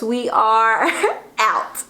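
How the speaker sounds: drawn-out sign-off broken by a laugh; slow, giggly second go at the sign-off; high-voice sign-off. Real, not acted. Retrying the sign-off to viewers, laughing into it. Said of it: "like, the high voice".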